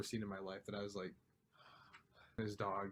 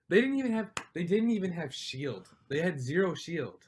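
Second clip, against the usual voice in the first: high, loud, exasperated protest; upset rant; 6 semitones higher, 7 dB louder than usual. Real, not acted. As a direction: protest in real outrage, pitch and volume jumping up. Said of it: "I'm very upset with Fortnite".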